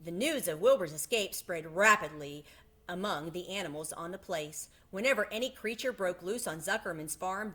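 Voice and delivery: plain reading voice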